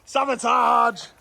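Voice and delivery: high, loud, slowly drawn-out mock accusation; mock outrage. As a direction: cry foul in jest. Joking about Tom's tree pulling.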